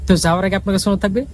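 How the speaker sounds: ordinary talk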